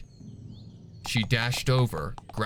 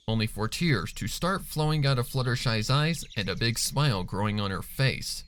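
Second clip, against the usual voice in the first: usual speaking voice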